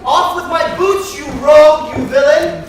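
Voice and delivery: angry master shouting at his servant; angry yell. Acted. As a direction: blast the rogue for fumbling. Master berates servant with boots.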